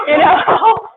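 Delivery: high, loud laughing talk; giggling while telling the story. Real, not acted. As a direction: laugh through the words.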